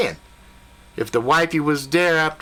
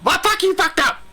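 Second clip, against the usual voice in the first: indignant outburst; 14 semitones higher than usual. Retold or imitated. Hunter snapping back at Billy, high indignant outburst. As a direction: throw it back at him in disbelief.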